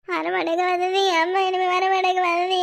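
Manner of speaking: sustained sing-song mock lament; non-stop crying. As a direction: wail it out, stretching the last words. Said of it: "மூச்சு விடாம அழுதுகிட்டு". (ராகம் இழுத்த ஒப்பாரி)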